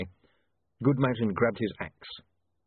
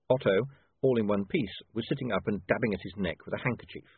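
usual speaking voice

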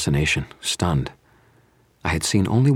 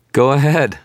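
high, loud, breezy dare; cheerful tone; 11 semitones higher, 8 dB louder than usual. A narrator's reading. Wizard inviting his killers on, cheerful. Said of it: "I said in a cheerful tone".

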